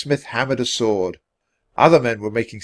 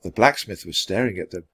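usual speaking voice

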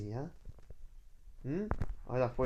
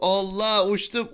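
amazed exclaim; 4 semitones higher, 8 dB louder, slower than usual. Real, not acted. Just flew off the bike.

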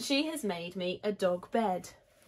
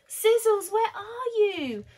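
high, loud, stretched dog call; 13 semitones higher, 7 dB louder than usual. A narrator's reading. Children keep searching for their lost dog, calling loudly. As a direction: shout for a dog that has run off.

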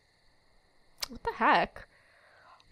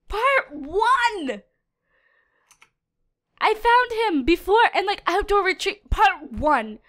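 higher and louder, hammering each part one; exasperated rant; 7 semitones higher, 4 dB louder, slower than usual. Real, not acted. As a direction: vent the frustration hard. Ranting about repeated failures.

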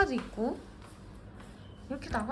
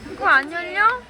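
calling out; 6 semitones higher, 6 dB louder than usual. Real, not acted. Calling children across room.

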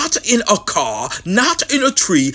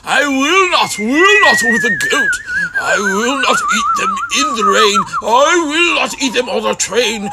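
louder, much higher, fed up; exasperated shout; 10 semitones higher, 4 dB louder than usual. Acted. Grump losing patience with Sam, shouting. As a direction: blow up at him.

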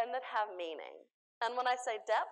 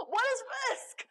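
panicked question; 11 semitones higher than usual. Acted. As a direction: ask it like the sky is falling. Mock panicked question about risk.

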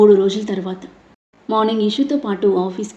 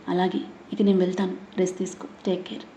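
faint smile; 7 dB quieter than usual. A narrator's reading. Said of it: "అంది సన్నని నవ్వుతూ".

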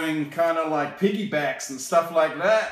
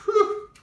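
breathy laughing whew, pitched way up; high laughing whew; 4 dB louder than usual. Real, not acted. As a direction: let out a high amused whew. Reacting to freaky monsters.

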